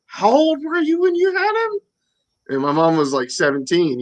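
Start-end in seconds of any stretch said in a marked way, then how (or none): 0.0-1.8 much higher, put-on voice of the nosy woman; mock woman's voice. Retelling a stranger asking mom about him, high mock voice. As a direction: squeak it out like a gossiping lady. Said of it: "She goes".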